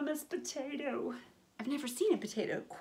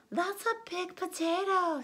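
higher wife voice; 8 semitones higher than usual. Acted. The wife echoes the farmer, higher voice. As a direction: lift it up as the wife. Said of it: "said the wife".

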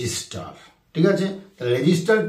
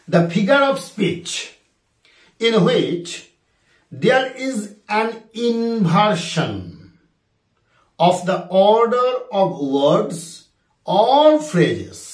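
drawn-out, word by word; slow dictation; 4 semitones higher, much slower than usual. Shown as a demonstration. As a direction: dictate the question slowly. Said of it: "কোশ্চেনটা আরেকবার বলি".

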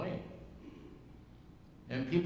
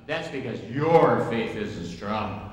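stern scolding; 10 dB louder, slower than usual. Retold or imitated. Pentecostal leader scolds him.

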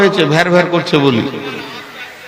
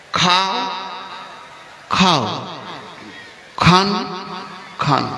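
soft, tender pleading to eat; softly urging a sulking son to eat; loving coaxing; 9 dB quieter, slower than usual. Shown as a demonstration. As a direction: coax gently like a mother feeding her child. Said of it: "এটা হচ্ছে মহব্বতের প্রতীক".

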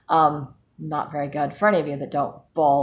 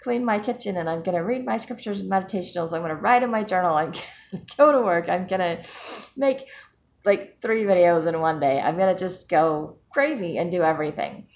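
high, bouncy can-do voice; playfully imitating her own overexcited self; mock Wonder Woman; 5 semitones higher than usual. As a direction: pump yourself up like a superhero. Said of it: "now I'm Wonder Woman".

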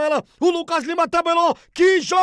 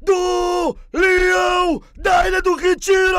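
thrilled shouting goal call; explosive goal commentary; 4 dB louder than usual. Real, not acted. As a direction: shout out the late winner in delight. Late winning goal call.

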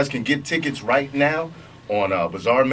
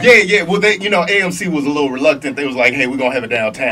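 loud, high, fast and eager; excited; 6 semitones higher, 5 dB louder, faster than usual. Real, not acted. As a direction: burst out with excitement. Excited telling about AMC.

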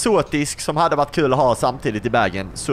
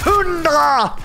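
high, loud yell; piercing gamer yell; shouted exclamation; 14 semitones higher, 5 dB louder than usual. Real, not acted. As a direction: shout it out at the top of the voice. Calling out a hit in game.

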